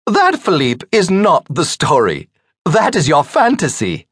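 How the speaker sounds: high woman's voice putting him down; pointed, high-pitched correction; teasing hostess retort. A narrator's reading. Margaret correcting Philippe at the table, high and teasing.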